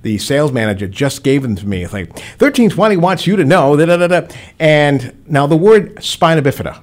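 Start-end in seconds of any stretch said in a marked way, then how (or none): 2.4-4.3 radio live read. Retold or imitated. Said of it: "a live read".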